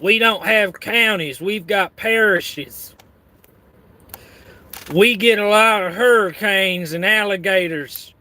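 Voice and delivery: exaggerated Cajun storyteller; Cajun accent. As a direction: lay on a hammy Cajun accent. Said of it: "That's my Cajun accent".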